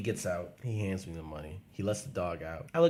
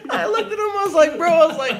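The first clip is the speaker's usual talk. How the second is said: high laughing voice, louder; 12 semitones higher, 5 dB louder than usual. Real, not acted. Storyteller cracking up at his own story's ending. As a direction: squeeze the words out through laughter.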